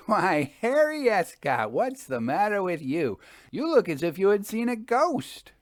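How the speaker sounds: Jasper, amused friend. A narrator's reading. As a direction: tease him about seeing a ghost. Narrator voices Jasper.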